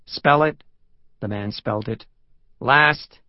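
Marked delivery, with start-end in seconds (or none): 0.1-0.6 loud, higher police command; punchy, impatient demand; curt order. Acted. Sergeant curtly questions the man. 2.6-3.2 loud, high one-word question; punchy police questioning; curt demand. Acted. Sergeant curtly questions the man.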